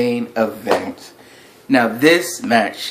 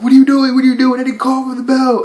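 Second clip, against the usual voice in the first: much higher and louder, protesting ref; frantic referee; 12 semitones higher, 4 dB louder, faster than usual. Retold or imitated. Referee yelling at the timekeeper, high frantic protest. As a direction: shout it like a panicked ref denying the bell. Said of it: "the ref's like".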